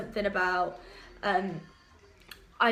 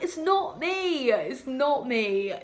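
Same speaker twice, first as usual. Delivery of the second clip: slow, drawn-out protest; mock-innocent denial; 3 semitones higher, 4 dB louder, much slower than usual. Accused meower answering her workmates, mock-innocent denial. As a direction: protest it slowly, stretching each word in fake innocence.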